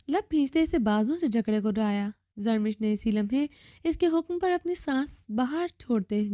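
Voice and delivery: usual speaking voice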